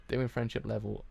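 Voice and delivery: ordinary delivery, nothing marked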